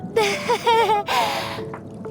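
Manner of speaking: high, awkward laugh; nervous laugh. Acted. Nervous laugh about the Knave.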